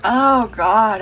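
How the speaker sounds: loud gushing "Oh God"; overwhelmed exclamation. Real, not acted. Reader moved by the cards, loud exclamation. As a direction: blurt it out, overwhelmed.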